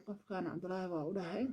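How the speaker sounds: ordinary talk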